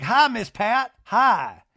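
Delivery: high, loud imitated greeting. J T hobbling over to Miss Pat, loud high greeting. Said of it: "He's like".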